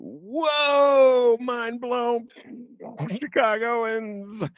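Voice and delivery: mock mind blown. [name] mocking Chicagoans' shock, high hammed-up amazement. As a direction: act stunned, over the top.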